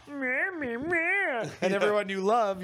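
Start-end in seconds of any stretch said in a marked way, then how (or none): 0.1-1.4 high, sneering "meh meh meh"; mocking blah blah. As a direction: sneer it in a high nasal mock. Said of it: "you read everything".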